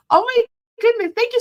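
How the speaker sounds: high, loud gasp of surprise; surprised. Real, not acted. Vlogger learns the viewer she took for a man is a mom, surprised. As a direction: caught off guard, delighted.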